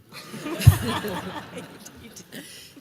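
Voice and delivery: high airy giggle rising; breathy laugh. Real, not acted. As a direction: laugh it off, delighted. Laughs at horse's "I'm done.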